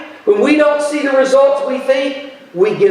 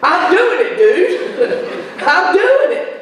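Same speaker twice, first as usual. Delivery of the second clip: higher, laughing self-parody of a big vow; laughing mock pledge; 6 semitones higher, much slower than usual. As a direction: laugh through your own empty promise. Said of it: "And I'm going".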